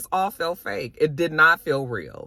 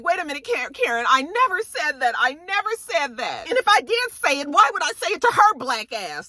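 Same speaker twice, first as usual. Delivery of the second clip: high-pitched insistent denial, acted; mock protest; 9 semitones higher than usual. Candace pleading innocence to Karen, mock indignant.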